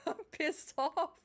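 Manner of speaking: laughing through mock anger; giggly, slow, pretending to be mad. Real, not acted. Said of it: "good to see you in a happy mood".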